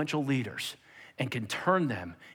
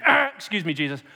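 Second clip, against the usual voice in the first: interrupting disciple; 2 semitones higher, 4 dB louder than usual. Acted. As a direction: butt in boldly on someone important. Acted Peter interrupting Jesus.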